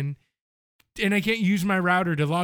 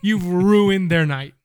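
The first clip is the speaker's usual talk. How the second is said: slower and louder, stressing ruined; slow emphatic repeat; 7 dB louder, slower than usual. Acted. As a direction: hammer home "ruined", slow and loud.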